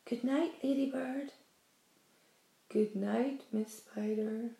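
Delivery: soft, hushed good night; whispered good night. A narrator's reading. James saying soft good nights to his friends. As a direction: breathe it so no one wakes.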